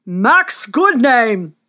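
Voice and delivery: slow, loud royal proclamation; booming warrior king. Acted. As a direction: thunder the name across the arena. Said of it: "thundered the king".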